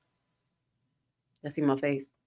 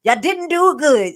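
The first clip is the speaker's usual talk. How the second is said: mock Tiffany Henry; 9 semitones higher, 11 dB louder than usual. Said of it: "talking about".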